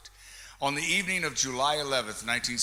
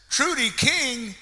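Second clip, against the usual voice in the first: calling out; 10 semitones higher, 6 dB louder than usual. Real, not acted. Emcee searching the crowd for the fan winner, loud calling. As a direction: call her name out across the hall.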